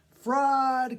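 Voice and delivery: high, loud furious cry; maddened crowd cry. Acted. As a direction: hurl it like a raging crowd. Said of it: "cried the maddened thousands".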